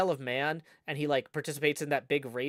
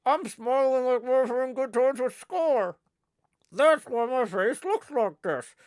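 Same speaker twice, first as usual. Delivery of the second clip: high, loud ventriloquist puppet voice; 4 semitones higher, 4 dB louder than usual. Acted. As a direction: ventriloquize the puppet without moving your lips. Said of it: "He'd be more like".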